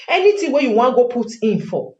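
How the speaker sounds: normal talking voice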